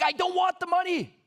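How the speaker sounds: high-pitched, indignant retold outburst; shocked and mad. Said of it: "I was so shocked, mad".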